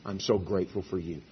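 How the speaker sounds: soft, gentle thanks; tender wife. Pretend. A wife telling her husband she's grateful, soft and tender. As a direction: say it softly like you mean every word. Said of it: "If a wife would say to her husband".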